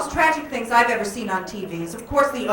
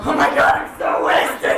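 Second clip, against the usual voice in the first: put-on drunk party girl; 3 semitones higher, 3 dB louder than usual. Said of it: "you go up to a guy and you go".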